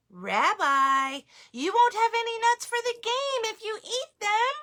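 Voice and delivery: high chiding grandma voice; grandmother voice. Acted. Baba Brena teasing the nut-eating bear, high. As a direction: scold gently like a grandma.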